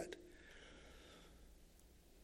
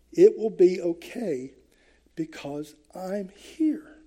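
tender comfort. Retold or imitated. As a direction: soothe them gently, as a loving father would. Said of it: "he's almost whispering to us".

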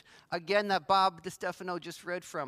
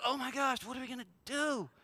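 higher, quick, half-hushed fake panic; mock freak-out; 4 semitones higher, 4 dB quieter, faster than usual. Preacher imitating what Jesus didn't say, mock panic. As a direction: play at fretting helplessly, under your breath. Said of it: "Jesus wasn't freaking out about".

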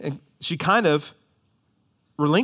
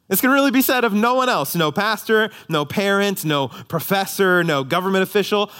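usual speaking voice

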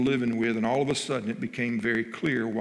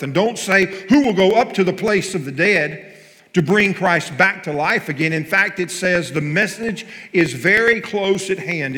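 loud, higher preaching voice proclaiming the verse; full-force preaching; 6 semitones higher, 9 dB louder than usual. Acted. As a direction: preach it out to the congregation. Preacher reading scripture at full force.

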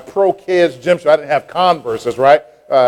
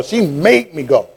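normal talking voice

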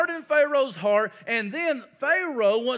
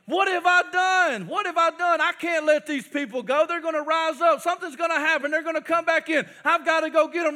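panicked Pharaoh; 5 semitones higher, 3 dB louder than usual. Acted. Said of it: "Pharaoh got this epiphany".

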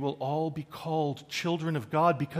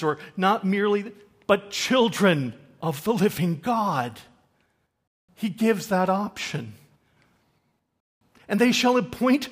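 loud, raised-pitch sermon delivery; forceful preaching; 6 semitones higher, 9 dB louder than usual. Real, not acted. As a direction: preach it out in emphatic bursts with pauses.

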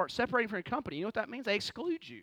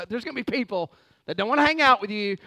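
ordinary talk

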